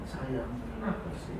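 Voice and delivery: softly cooing to a loved one; tender. Pretend. Husband caressing his wife, softly cooing. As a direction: soothe her with a hushed voice. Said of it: "Sambil disayang".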